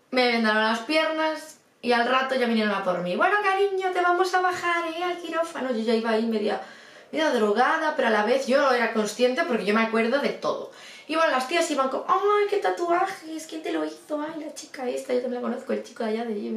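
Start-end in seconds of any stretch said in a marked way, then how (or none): 3.1-5.7 higher-pitched, coaxing hospital staff voice; cooing nurse. Retold or imitated. Nurse fetching the patient for surgery, cooing and high. Voicing nurses coming for her. 12.1-16.5 chattering nurses. Retold or imitated. Nurses admiring the drowsy patient's tattoos, idle high chatter. Voicing chatty women wheeling her along.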